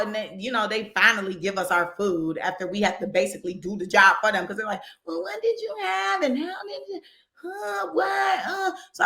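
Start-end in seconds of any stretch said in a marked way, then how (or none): 5.0-8.7 higher, softer mocking voice of a confused cashier; mock clueless worker. Customer mocks the confused drive-through worker, higher and softer. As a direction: play the flustered worker fumbling the order.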